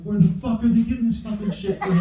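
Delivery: retold parental exasperation; mock exasperated outburst. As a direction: demand an answer in mock outrage. Retells outburst to his wife.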